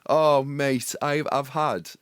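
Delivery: loud, high, triumphant cheer; excited. Real, not acted. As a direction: crow it like a victory. Celebrating his own comeback.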